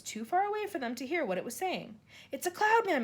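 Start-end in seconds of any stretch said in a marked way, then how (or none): 2.3-3.0 high, frightened cry. A narrator's reading. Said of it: "Miss Spider cried".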